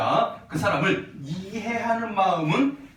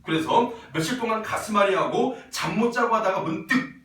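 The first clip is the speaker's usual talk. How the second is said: raised, measured classroom reading with firm stresses; reading aloud; 5 semitones higher, 4 dB louder than usual. A narrator's reading. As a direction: read the passage out clearly to the class. Reads the text aloud. (또박또박 높여 읽는 낭독조)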